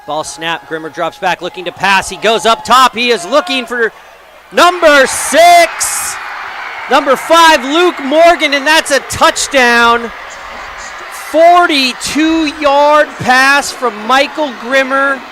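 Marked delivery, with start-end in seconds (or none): 2.1-10.1 builds to a loud, high, slow touchdown call; rising play-by-play excitement; excited touchdown call. Real, not acted. 11.3-15.2 slow, loud, high recap call; drawn-out stadium call; excited score call. Real, not acted. Excited replay of scoring pass.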